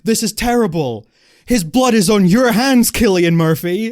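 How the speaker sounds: mock panicked accusation. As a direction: ham up his outrage at the end. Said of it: "He's like".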